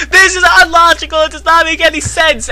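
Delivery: high, fast character outcry; hammed-up high-pitched protest; indignant bellow. A narrator's reading. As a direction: bellow it out in exasperation. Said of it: "Ebony bellowed out into the air".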